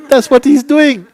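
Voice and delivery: loud, high-pitched exclamation; shouted imitation of an excited partner; retold outcry. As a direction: act out her bursting out with it. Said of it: "And she said".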